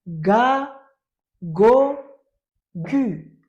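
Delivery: teacher and pupil chanting ga go gu; loud joint drill. Shown as a demonstration. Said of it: "Encore une fois".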